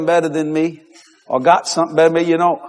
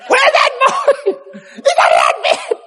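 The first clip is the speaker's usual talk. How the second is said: daddy calling; slower than usual. Retold or imitated.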